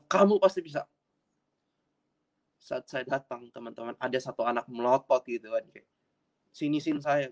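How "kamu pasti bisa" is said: raised, louder voice of God reassuring; emphatic encouragement. Retold or imitated. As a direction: punch it out high and loud, as God's answer. Acting God's encouraging reply in story. (seruan lantang menyemangati)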